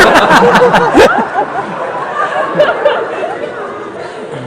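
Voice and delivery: high, long burst of laughter. Real, not acted. Comic laughs at his pet story with a high laugh. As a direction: cracks up at his own story.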